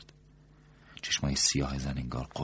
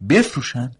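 higher, louder indignant cry; shouting; 11 semitones higher, 13 dB louder than usual. A narrator's reading. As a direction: shout it back in disbelief. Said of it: "مرد داد زد". (فریاد دکتر با ناباوری)

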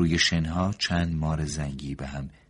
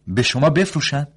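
indignant shout, stress on شما, higher and louder; the doctor cries out at the woman's demand; 12 semitones higher, 13 dB louder than usual. A narrator's reading. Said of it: "مرد داد زد". (فریاد با تأکید روی «شما»)

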